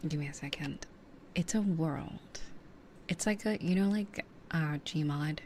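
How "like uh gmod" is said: ordinary talk, nothing marked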